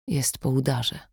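soft, hushed words; whispered. Acted. Sasza answering the kidnapper on the phone, hushed. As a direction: barely voice it, stunned. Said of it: "wyszeptała Sasza".

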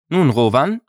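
put-on the girl pulls him up short, stern and loud; stern challenge. A narrator's reading. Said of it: "begann sie mit strenger Stimme".

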